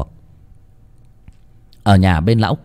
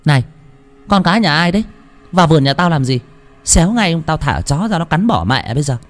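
higher, sharp warning; shooing off the child; 7 semitones higher, faster than usual. A narrator's reading. Loan yells at the strange child to get out. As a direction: chase the intruder off.

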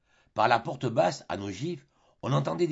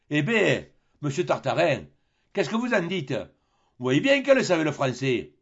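louder, higher mocking captain; captain gloats over the cheated traveller, louder and higher; sly sea captain; 4 semitones higher, 5 dB louder than usual. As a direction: tease him like a smug old skipper. Barbassou voiced mocking Tartarin.

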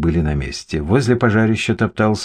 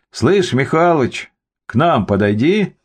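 calling out; 7 semitones higher than usual. A narrator's reading. Policeman beckons the summer resident, calling out. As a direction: call someone over, pitched up. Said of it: "крикнул участковый".

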